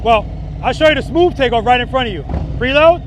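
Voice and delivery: loud, high, called over the bike; shouting over engine. Real, not acted. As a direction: call it out over the engine noise. Demo ride, talking over engine.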